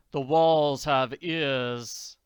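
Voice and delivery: slow ominous caution; slow warning. Acted. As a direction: drag it out darkly. Count warning slowly.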